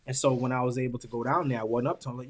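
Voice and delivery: usual speaking voice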